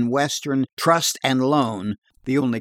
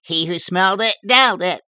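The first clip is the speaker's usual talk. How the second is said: raised, louder sing-song taunt; higher, louder playground rhyme; schoolyard rhyme; 7 semitones higher, 5 dB louder than usual. A narrator's reading. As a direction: chant it like a schoolyard jibe. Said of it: "schoolyard rhymes like".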